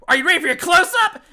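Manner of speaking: hammed-up bad movie tagline; shitty line reading. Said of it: "Really shitty. It's horrible".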